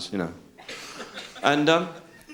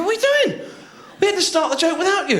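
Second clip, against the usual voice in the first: high-pitched exasperated scolding; hammed-up indignation; indignant outburst. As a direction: scold the latecomer in a raised pitch. Acted quarrel with latecomer.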